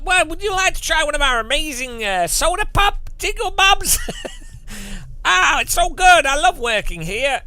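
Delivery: high, loud, hammed-up sales gush; over-positive American waiter. American waiter pushing house specials on a diner, hammed-up over-positive. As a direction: parody a relentlessly cheery American server. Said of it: "I don't know what accent that is".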